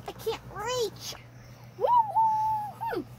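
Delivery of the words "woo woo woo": excited whoop. Real, not acted. Cheering climbing success.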